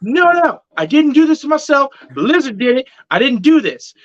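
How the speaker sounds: high-pitched panicky denial; acting out flustered protest, voice jumping up; retold freak-out. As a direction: protest your innocence in mock panic. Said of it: "I'm freaking out now".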